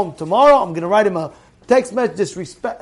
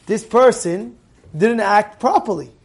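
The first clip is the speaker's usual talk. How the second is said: ordinary talk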